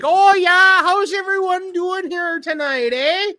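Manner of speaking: high, slow, folksy Canadian drawl with eh; Canadian accent. Retold or imitated. Widow greeting the London crowd, mock Canadian accent. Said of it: "she's just like".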